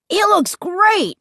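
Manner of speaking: put-on high, drawn-out boyish insistence; stubbornly enthusiastic kid; insisting. A narrator's reading. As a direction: insist on it, pitch way up. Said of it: "Luke insisted".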